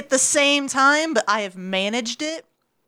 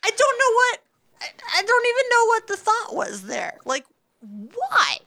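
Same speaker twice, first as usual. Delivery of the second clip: incredulous; 10 semitones higher than usual. Real, not acted. Incredulous about the date.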